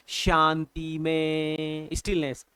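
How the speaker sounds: slow, long-held words on a level pitch; drawn-out stillness. Shown as a demonstration. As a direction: stretch the words out in one steady tone. Acting out stillness while teaching the line.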